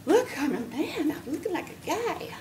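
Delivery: lightly voiced proud teenage boy; excited teen son. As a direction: show off like a boy who just hit puberty. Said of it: "he was thinking".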